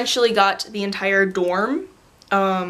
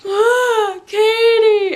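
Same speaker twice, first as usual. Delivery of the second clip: high, loud wailing cry; mock freak-out; 11 semitones higher, 6 dB louder, much slower than usual. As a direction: imitate a brother wailing in distress. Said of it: "freak out and cry together".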